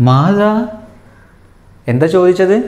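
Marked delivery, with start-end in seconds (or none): 0.1-0.5 a high, concerned mother's question; mother asking. Acted. Mother comes out, worried, asking the chick: high concerned question. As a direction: ask it like a worried mum. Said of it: "ഫറഹയുടെ ഉമ്മ വന്ന് ചോദിക്കുകയാണ്".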